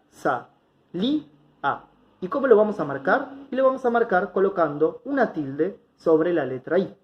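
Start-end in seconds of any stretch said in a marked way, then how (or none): 0.1-1.9 "sa, li, a" sílaba por sílaba, con pausas; separado en sílabas. Shown as a demonstration. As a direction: separa "sa", "li", "a", realzando la "li". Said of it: "vamos a separar". (marca cada sílaba: "sa", pausa, "li", pausa, "a")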